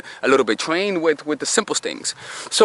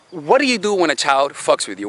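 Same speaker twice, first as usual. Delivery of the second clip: normal talking voice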